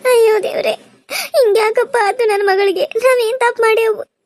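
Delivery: tearful prayer. Acted. Mother begging god for her daughter, weeping aloud. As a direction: sob out the prayer to Shiva.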